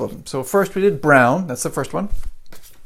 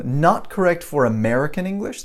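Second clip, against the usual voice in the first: ordinary talk, nothing marked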